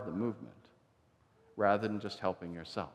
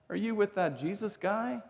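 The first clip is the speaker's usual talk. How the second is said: high, prying challenge; lightly voiced accusing girl; mock accusing servant girl; 9 semitones higher than usual. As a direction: poke at him like a nosy servant girl.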